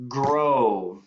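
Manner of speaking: usual speaking voice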